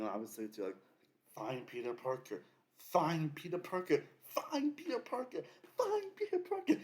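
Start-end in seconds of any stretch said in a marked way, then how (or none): 1.3-6.7 imitating Ned's spell. Ned casting a spell to find Peter, slow chant rising to very high. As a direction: repeat it like a spell, each time higher. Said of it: "Ned using his, like, little thing".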